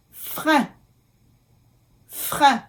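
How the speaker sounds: "Frein" in French, uvular r, nasal vowel; careful French pronunciation. Shown as a demonstration. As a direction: model "Frein" with a nasal ending. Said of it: "E I N".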